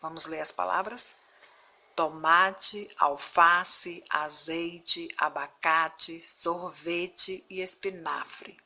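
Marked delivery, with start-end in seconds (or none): 2.0-8.6 toMAte, alFAce, aZEIte, final E weak; stress before last syllable. Shown as a demonstration. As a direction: read Tomate, alface, abacate slowly, strong next-to-last syllable. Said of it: "A sílaba mais forte dessas palavras não é a última". (Tomate, alface, espinafre com a penúltima sílaba forte)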